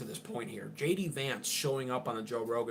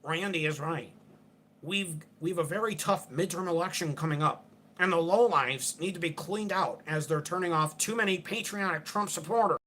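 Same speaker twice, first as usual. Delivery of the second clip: mocking, higher-pitched pathetic voice; Mark Levin; 5 semitones higher than usual. Host reading out a pundit's post, mock pathetic voice. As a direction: do a pathetic whiner, pitched up and put on. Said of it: "read it in his pathetic voice".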